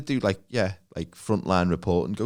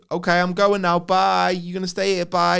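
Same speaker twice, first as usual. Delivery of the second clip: higher, louder mock goodbye; parent's raised sing-out warning; parent bluffing to a toddler; 8 semitones higher, 5 dB louder than usual. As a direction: call out a put-on farewell to a little kid.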